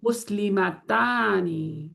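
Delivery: مسلمتانی drawn out very slowly, tani held long; slow demo word. Shown as a demonstration. Teacher building the dual on the board, very slow. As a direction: let مسلمتانی unfold slowly so the ending is heard. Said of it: "تا کا sound بھی ہے".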